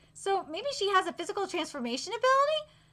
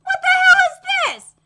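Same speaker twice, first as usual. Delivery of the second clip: a much higher, louder shocked yell; startled shout; 11 semitones higher, 10 dB louder than usual. Acted. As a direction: shout it out in alarm. Startled by restraints being put on.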